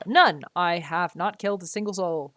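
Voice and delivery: ordinary talk, nothing marked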